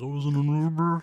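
distorted monster voice. Retold or imitated. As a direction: say it like a masked monster. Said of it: "you have them do those monster voices".

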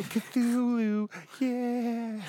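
high-pitched cartoon character voice; Cartman. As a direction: draw it out high, like a cartoon kid. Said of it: "trying to do an impression of Cartman".

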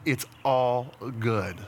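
slow, low pronouncement; assured verdict. Acted. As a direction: stretch it out, low and fully assured. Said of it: "what he says when he says".

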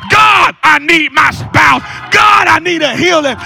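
loud preacher climbing into high, sustained shouts; fervent shouted preaching, key words drawn out; full-force preaching. Real, not acted. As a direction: cry out to God and rouse the congregation.

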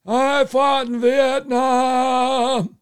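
very high, very slow stretched monotone; Kerry impression. Kerry campaigning on his war record, droning slowly. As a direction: drone it out long and high like a stiff senator.

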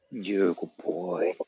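low, slow, soft praise; praising the dog. Real, not acted. Owner praising his dog, low slow soft murmur.

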